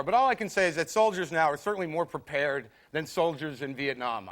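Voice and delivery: normal talking voice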